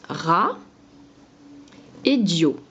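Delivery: Ra puis dio, avec une pause entre; sépare nettement les deux syllabes; syllabes détachées. Shown as a demonstration. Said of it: "ça va être les deux syllabes". (syllabes détachées lentement)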